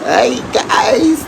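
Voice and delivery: high, slow drawn-out "hi guys"; imitating a girl's greeting. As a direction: stretch it out like a cheery vlogger girl. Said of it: "എന്ന് പറഞ്ഞ".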